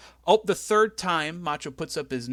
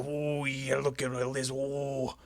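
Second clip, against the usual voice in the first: lightly voiced Macho Man, slowing on the last line; a hint of Macho Man sneering at Heenan; Macho Man impression; 3 dB louder, slower than usual. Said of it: "I look at my wife".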